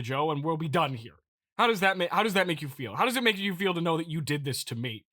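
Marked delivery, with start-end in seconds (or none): none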